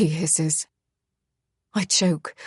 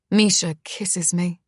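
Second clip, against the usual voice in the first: usual speaking voice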